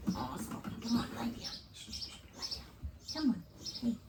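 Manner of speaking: very soft aside to a pet; hushing the dog. Real, not acted. Owner telling her restless dog to lay down, quiet hushing. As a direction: hush the dog under your voice.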